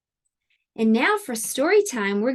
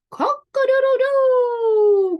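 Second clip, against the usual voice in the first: high, loud, long-held crow; crowing rooster; 13 semitones higher, 7 dB louder, slower than usual. Acted. Rooster crows at the spider, loud high crowing. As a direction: crow it out, stretching the last note. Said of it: "crowed the rooster".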